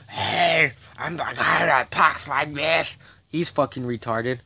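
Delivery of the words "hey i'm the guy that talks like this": slow mock voice of a jail guard; mocking imitation. Mocking a jail guard in a slow put-on voice. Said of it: "There's a man that talks like this".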